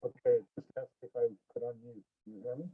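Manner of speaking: muffled, as if through a wall; bad mic, muffled and distant. Real, not acted. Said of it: "it sounds like you're muffled".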